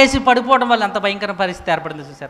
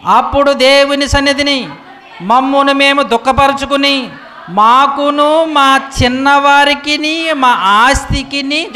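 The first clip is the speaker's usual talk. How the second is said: slow, weighty reading of scripture, louder; 5 semitones higher, 5 dB louder, slower than usual. Acted. Preacher reading Ezra to his congregation, slow and solemn. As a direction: read each phrase slowly like a sacred text.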